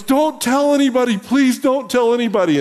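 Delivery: high-pitched, urgent begging; retold pleading. Friend begging the pastor after shooting his dog, pleading. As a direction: beg him to keep it secret.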